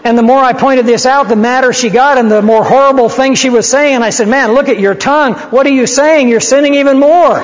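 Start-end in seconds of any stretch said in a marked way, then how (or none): 4.3-7.4 climbing higher and louder, pointing out her sin; rising retold rebuke. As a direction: build the rebuke up, higher each line. Retold rebuke of angry woman.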